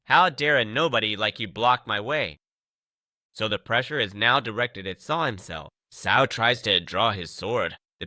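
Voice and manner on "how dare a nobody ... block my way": usual speaking voice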